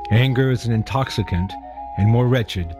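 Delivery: ordinary delivery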